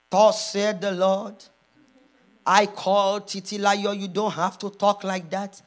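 slow, solemn mock pronouncement; imagined voice of God. God rebuking people for her sake, solemn drawn-out pronouncement. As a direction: intone it evenly like a divine decree.

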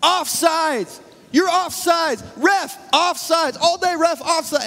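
high, strained yell of an angry defender; mock yelling opponent. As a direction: squawk it at the ref like the guy guarding you. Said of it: "he kept saying".

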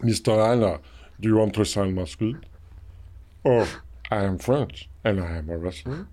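low rumbling giant impression; Andre the Giant. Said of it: "sounds like Andre the Giant".

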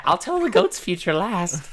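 high, slow fortune-teller voice. Acted. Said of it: "She's gonna say".

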